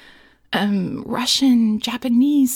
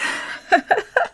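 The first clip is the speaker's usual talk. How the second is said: high, breathy burst of laughter; helpless high giggle; 12 semitones higher, 4 dB louder than usual. Real, not acted. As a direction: crack up mid-sentence. Laughs at the request she reads.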